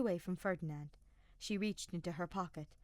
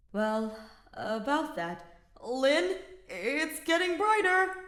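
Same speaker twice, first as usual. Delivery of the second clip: uneasy; 8 semitones higher, 10 dB louder, slower than usual. Acted. Kaspar uneasy over speaker.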